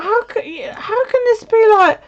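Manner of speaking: high incredulous squeal of a retold thought; retelling her shock at still being hungry with the flu; mock disbelief. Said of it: "I was just like".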